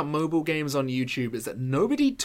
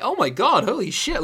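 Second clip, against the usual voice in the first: higher and louder, mock shock at the hate; hammed-up "oh my god" of disbelief; mock shocked outburst; 7 semitones higher, 5 dB louder than usual. Youtuber mimicking his own reaction to hate comments, mock shocked outburst. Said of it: "and you're like".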